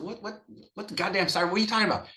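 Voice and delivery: mock outrage at the cart guy; incredulous outburst. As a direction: play it like you can't believe what you're hearing. Arguing with cart staff at siren.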